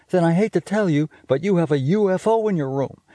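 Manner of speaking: high, quick bossy big-sister voice; a know-it-all sister teasing her brother; Judy's voice. Acted. Big sister scolding little brother about smell, high and bossy. Judy speaking in story.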